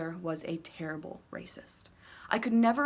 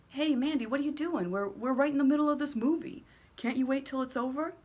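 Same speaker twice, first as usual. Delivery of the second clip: put-on kid voice; 6 semitones higher than usual. Girlfriend's boys protesting.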